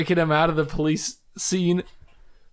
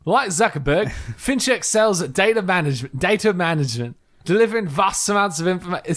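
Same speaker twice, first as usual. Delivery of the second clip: higher-pitched, shaky-grip Australian accent; put-on Australian, raised in pitch; attempted Australian accent; 4 semitones higher than usual. Shown as a demonstration. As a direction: try an amateur Australian accent. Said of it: "Can I do it in Australian accent?".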